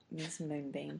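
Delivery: soft, laughing echo of "Miss Moonbeam"; laughing disbelief. Real, not acted. Hearing the cafe owner's name, a soft laughing "Miss Moonbeam?". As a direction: say "Miss Moonbeam" quietly through a laugh, as if it can't be real. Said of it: "that's literally the owner's name".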